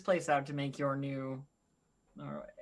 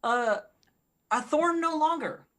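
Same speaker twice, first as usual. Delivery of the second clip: high, louder put-on voice; high character voice; 12 semitones higher, 7 dB louder than usual. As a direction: pitch it way up for your character.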